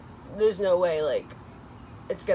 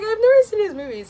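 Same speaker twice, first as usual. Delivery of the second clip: high, louder excited gush through a laugh; 13 semitones higher, 9 dB louder, faster than usual. Retold or imitated. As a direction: blurt it out, thrilled and almost crying. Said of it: "I was like".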